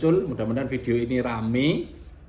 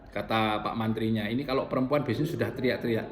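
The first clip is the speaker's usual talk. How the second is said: ordinary talk, nothing marked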